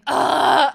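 loud exasperated ugh; loud frustrated groan. Retold or imitated. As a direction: let out a fed-up groan. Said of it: "I was pretty loud".

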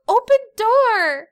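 put-on high, loud, slow toddler demand; little boy asking his mom to open the door, high and slow. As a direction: play a small boy demanding. Toddler voiced in story.

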